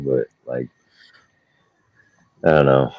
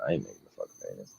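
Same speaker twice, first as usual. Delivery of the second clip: muttered grandma; 7 semitones lower, 16 dB quieter, faster than usual. Retold or imitated. Grandma voiced in story.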